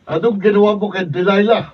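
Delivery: high, very loud challenge; furious shout. Acted. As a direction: shout at the kidnapper across the street. Hero confronting kidnapper. (galit na sigaw)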